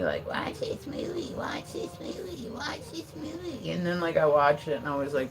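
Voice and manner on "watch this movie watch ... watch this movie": high breathy nagging, over and over; mocking pushy people. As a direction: parrot them in a silly high whisper. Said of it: "people are telling me".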